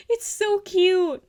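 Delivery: high, loud, slow gushing squeal; excited squeal. Real, not acted. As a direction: melt over how cute it is. Gushing over the drawing.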